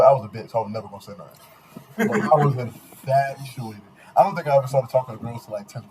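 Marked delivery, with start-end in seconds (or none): 2.1-3.8 drawn-out emphasis. Real, not acted. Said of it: "repeats for emphasis".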